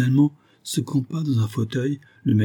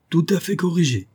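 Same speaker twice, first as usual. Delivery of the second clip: high, lightly amused answer; amused reply; 8 semitones higher than usual. A narrator's reading. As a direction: answer your old teacher in playful good humour. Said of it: "répondit en riant le capitaine".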